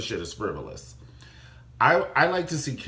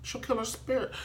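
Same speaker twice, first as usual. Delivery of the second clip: mock diva voice; 12 semitones higher, 6 dB quieter than usual. Imitating a character's warning.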